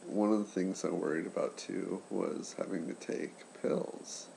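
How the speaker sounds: usual speaking voice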